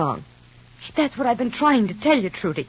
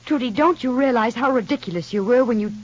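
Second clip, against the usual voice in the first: usual speaking voice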